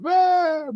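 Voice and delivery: short high mock yelp of panic; a kid's brief panicked cry, played for laughs; mock scream. Drowning kid calling to family, high mock scream.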